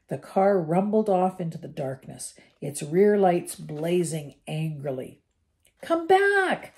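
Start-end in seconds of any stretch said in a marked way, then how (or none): 5.8-6.7 much higher, louder yell. A narrator's reading. Ron yells after the car as it drives off. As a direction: shout after the fleeing car. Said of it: "yelled Ron after it".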